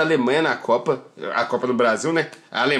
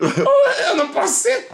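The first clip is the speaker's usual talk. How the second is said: laughing; 14 semitones higher, 3 dB louder than usual. Real, not acted. Brincadeira sobre ser alemão.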